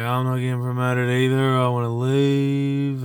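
slow, drawn-out imitation of a whining coworker; mocking whiner. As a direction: mimic a sulky colleague complaining. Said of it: "another guy's bitching about it".

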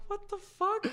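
very high-pitched mock disbelief; retold bafflement. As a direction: squeak it out as an imitation of a baffled friend. Retold friend's baffled reaction.

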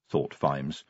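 plain reading voice

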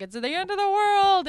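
put-on loud, high drunken wail; drunk doomsayer. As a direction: howl it like a drunk prophet of doom. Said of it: "this Irish guy in the corner is slobbering drunk".

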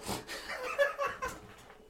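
high breathy laugh; burst of laughter. Real, not acted. Laughs mid-story.

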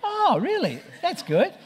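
very high-pitched imitation of the attendant; lovely Filipino attendant. Attendant reacts to hearing he's a pastor, high mimicked voice. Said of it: "I say to this lovely Filipino, uh, attendant".

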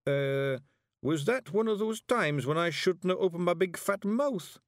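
high, hesitant Scottish voice; sheepish Scots simpleton. A narrator's reading. Daft warrior asks brother after his look, sheepish. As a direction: ask innocently in broad Scots.

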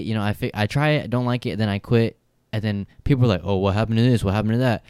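ordinary talk, nothing marked

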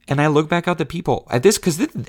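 ordinary talk, nothing marked

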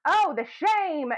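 slow, high, hammed-up Oh the shame; mock scandalized. Kentucky girl mocking her own shock at the scene. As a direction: drag it out like a scandalized church lady.

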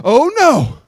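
loud, high cry of dismay; mock panic. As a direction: play a believer suddenly alarmed, hammed-up. Believer panics over checklist.

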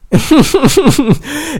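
very high, rapid string of helpless laugh-like cries; high upset laughing burst. Real, not acted. Speaker facing eviction, bursting into high upset laughter.

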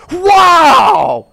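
exuberant. Retold or imitated. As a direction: yell it out like the miracle just landed. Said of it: "And now you're just exuberant".

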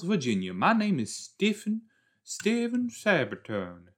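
put-on slow, high, grand Southern Virginia gentleman accent; mock Southern accent. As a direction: drawl it out as an old-time Virginian. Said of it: "is he gonna have an accent?".

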